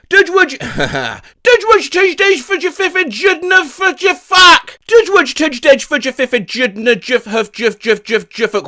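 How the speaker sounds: high, loud garbled gibberish; high mock babble. Shown as a demonstration. Reading the magnet noise as a silly sentence, high babble. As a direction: spit out nonsense words fast and high. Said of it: "determine exactly what noise it is".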